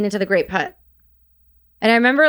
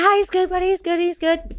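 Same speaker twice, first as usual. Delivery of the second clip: a high, thick Scottish accent, imitating a soldier; high Scottish accent calming a wounded man; 10 semitones higher than usual. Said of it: "a super thick Scottish accent".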